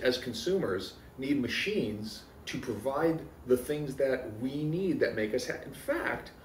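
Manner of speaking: normal talking voice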